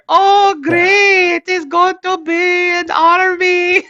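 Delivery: put-on very high, slow praising voice with Pakistani accent; Pakistani teacher accent. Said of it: "the teacher".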